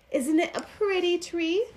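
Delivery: usual speaking voice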